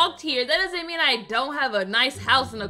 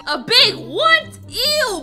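high, drawn-out disbelief; shocked disgust; 7 semitones higher, 4 dB louder, slower than usual. Pretend. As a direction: recoil in disgust, pitch jumping up. Shocked by the crude remark.

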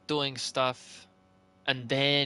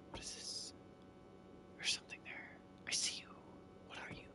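very soft, barely voiced; wary hush; 17 dB quieter, much faster than usual. Real, not acted. Player to himself, spotting something there, hushed. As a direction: keep it under your breath.

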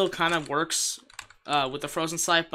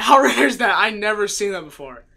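excited; 5 semitones higher, 10 dB louder than usual. Real, not acted. Rare item drop.

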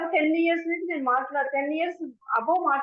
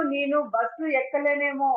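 ordinary delivery

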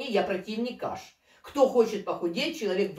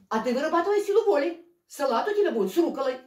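fast, higher nagging voice; mock bossy dietitian; 6 semitones higher, faster than usual. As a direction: rattle off orders like a pushy nutritionist. Mocking the dietitian, fast and high.

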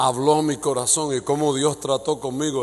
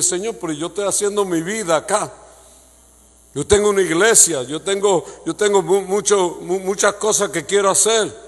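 pleading preaching; 5 semitones higher, 6 dB louder than usual. Acted.

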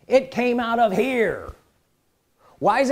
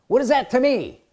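ordinary delivery

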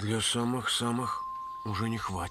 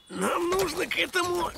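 shout. Acted. Urging friends to prepare.

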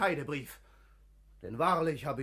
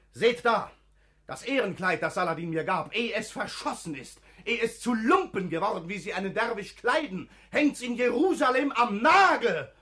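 worked up; 4 semitones higher, 6 dB louder than usual. Acted. Al-Hafi redet sich in Schwung.

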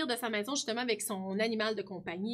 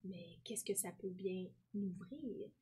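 slow, wondering whisper; 4 semitones lower, 10 dB quieter, slower than usual. Acted. Alex puzzling over the mystery key, whispered. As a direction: breathe the question as a secret.

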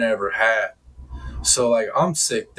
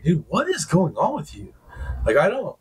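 baffled, higher-pitched challenge; retold exasperation; 6 semitones higher than usual. Confronting her about the attitude, exasperated. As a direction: replay demanding an explanation, exasperated.

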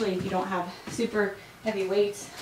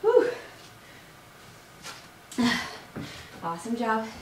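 out of breath; 3 semitones higher, 3 dB quieter than usual. Real, not acted. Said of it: "This is not easy, guys".

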